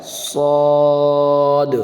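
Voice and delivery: صاد with a thick rounded long vowel; slow, heavy model صاد; heavy full-mouthed saad. Shown as a demonstration. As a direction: hold صاد full and level, like "sawd". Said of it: "heavy letter ہے".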